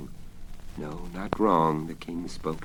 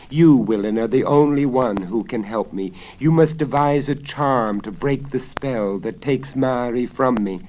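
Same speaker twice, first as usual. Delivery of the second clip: anguished cry; 7 semitones higher, 10 dB louder than usual. Acted. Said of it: "cried the king in anguish".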